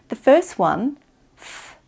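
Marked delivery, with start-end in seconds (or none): 1.4-1.8 soft puff of air through the teeth, no voice in th; unvoiced th. Shown as a demonstration. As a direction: blow th out between the teeth. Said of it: "is unvoiced".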